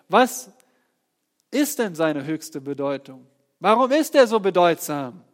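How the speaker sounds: rising preacher's question. Acted. Preaching at full force.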